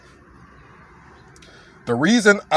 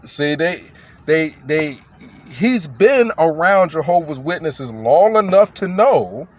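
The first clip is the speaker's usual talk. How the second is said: usual speaking voice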